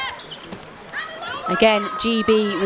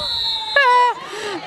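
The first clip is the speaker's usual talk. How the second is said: high laugh over a near miss; burst of laughter; 14 semitones higher than usual. Real, not acted. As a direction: laugh out at the shot.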